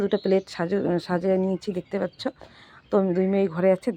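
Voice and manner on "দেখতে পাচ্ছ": ordinary delivery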